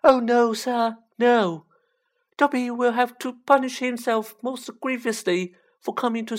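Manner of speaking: high, squeaky, alarmed elf; shuddering Dobby. A narrator's reading. Elf dreading punishment for visiting, high and alarmed. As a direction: protest high, starting loud then easing off. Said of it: "Dobby shuddered".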